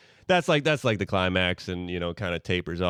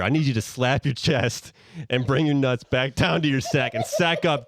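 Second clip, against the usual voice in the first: ordinary delivery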